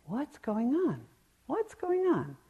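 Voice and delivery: put-on high, eager little child asking; curious child. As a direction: play a small kid who has to know. Imitating endlessly curious children.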